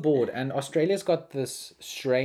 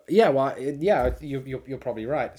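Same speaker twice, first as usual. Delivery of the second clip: ordinary delivery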